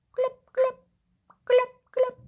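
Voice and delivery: high, popping "glub glub" fish noise; short high bubbly glubs, like a fish; playful fish glub. Pretend. Storyteller showing children what a fish says, playful high glubs. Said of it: "A fish says".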